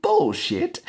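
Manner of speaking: very high mock scoff; scoffing Aussie mate. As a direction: squeal out a disbelieving bullshit. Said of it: "And he goes".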